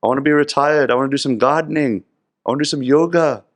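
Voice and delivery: a weary dad begging off; older Gopal. Retold or imitated. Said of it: "He says".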